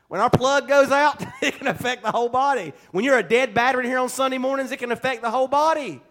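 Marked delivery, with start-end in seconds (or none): 0.1-2.7 louder, higher preaching through a laugh; amused and emphatic, chuckle breaking in. Real, not acted. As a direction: laugh while driving the point home. Joking car comparison to congregation, laughs.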